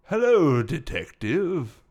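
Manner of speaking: put-on slow, drawn-out older English gentleman; older English accent. Gorilla greeting the detective, older English accent. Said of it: "starting with a older English accent".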